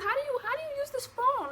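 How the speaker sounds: high-pitched frantic question, retold; panicked questioning. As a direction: act out asking the nurse in a panic, voice way up. Retelling her frantic question to the nurse.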